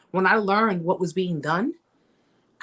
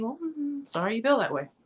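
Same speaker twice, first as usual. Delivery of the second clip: mock indifference; 6 semitones higher, 5 dB quieter than usual. Shown as a demonstration. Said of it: "Your response should be".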